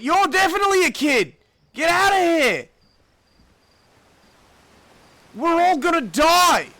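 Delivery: loud, high frantic yelling; panicked screaming. Pretend. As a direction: shout for everyone to run. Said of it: "That's what I would be saying".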